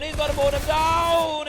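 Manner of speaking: building hype call; excited play-by-play. Real, not acted. Caster repeats, louder and higher.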